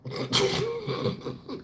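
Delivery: soft, high breathy laughing fit. Real, not acted. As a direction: wheeze out a quiet laugh. Laughs at his own remark.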